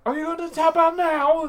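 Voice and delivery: very high, loud put-on woman's voice; bad Becky impression. Imitating a wrestler demanding a tap-out, high mock voice. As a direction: squeal it as an unflattering mimicry. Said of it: "How, how would she say that?".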